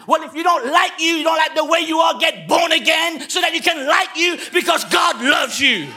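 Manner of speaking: climbing sermon shout; preaching at full force. Acted. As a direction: drive it home like a revival preacher. Exhortation to the congregation.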